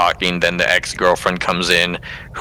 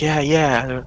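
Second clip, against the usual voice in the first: higher, put-on quick agreement; mock eager yes; 7 semitones higher than usual. Fan answers about an exit behind the screen, mock eager. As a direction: lightly imitate an eager reply. Retold reply during theater fire.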